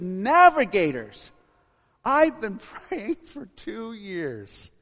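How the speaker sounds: high, very loud, slow exclamation; overjoyed shout. Acted. Man whose prayer is answered greets missionary, overjoyed shout. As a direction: cry it out, stretching the words in amazement.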